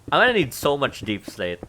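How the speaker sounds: normal talking voice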